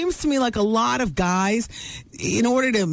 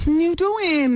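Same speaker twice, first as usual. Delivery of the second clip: Joey catchphrase; 8 semitones higher, slower than usual. Pretend. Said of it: "We should just say it".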